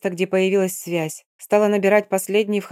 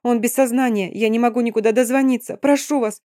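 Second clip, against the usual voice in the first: higher, urgent and pleading; desperate pleading; 3 semitones higher than usual. Acted. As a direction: beg the driver, rising panic. Begging stranger after crash.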